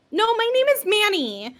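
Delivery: put-on high-pitched little boy voice. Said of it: "the little boy just pokes his head out and he goes".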